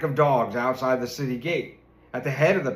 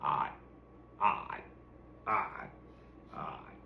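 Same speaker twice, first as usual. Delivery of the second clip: low croaks fading away; frog croaking; 10 semitones lower, 13 dB quieter, slower than usual. A narrator's reading. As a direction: croak lower and fainter each time. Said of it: "the frogs kept croaking".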